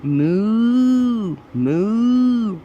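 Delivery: loud, high mooing cow. Acted. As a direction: moo like a big cow. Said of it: "goes the cow".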